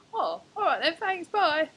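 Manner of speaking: mock flustered quick exit; hasty goodbye. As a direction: get out of there fast.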